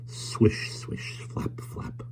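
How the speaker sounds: fading to a whisper; whispered sound effect. A narrator's reading. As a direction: breathe the swishes away to nothing. Sound of curtains in wind.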